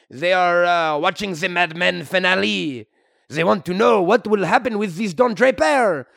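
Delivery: put-on comic French-Canadian accent; hammy Québécois hero voice. French-Canadian Wolverine character.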